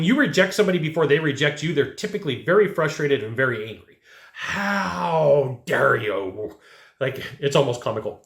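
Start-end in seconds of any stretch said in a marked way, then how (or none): 4.3-6.6 drawn-out, very slow mock outrage; hammed-up huffy client voice; mock indignant client. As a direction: stretch it like an offended customer. Mocking an angry rejected client.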